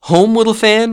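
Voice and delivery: put-on high, loud boyish question; young boy. A narrator's reading. Boy answering his little sister's news, high boyish voice. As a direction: ask it as an amazed child. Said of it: "returned the boy".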